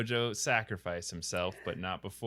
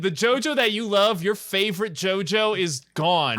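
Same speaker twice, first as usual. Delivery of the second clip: melodramatic wail; 10 semitones higher, 8 dB louder than usual. Pretend. Melodramatic insistence to Fran.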